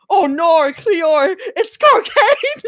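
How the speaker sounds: high, loud gasping "cocaine" in a mock Australian accent; Australian accent, horrified and hammed up; shocked cry in an Australian accent. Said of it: "Australian accent".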